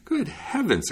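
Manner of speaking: high-pitched burst of surprise; amazed miller, voice jumping up; astonished exclamation. A narrator's reading. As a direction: exclaim in amazement, pitch leaping up then falling. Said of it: "exclaimed the miller".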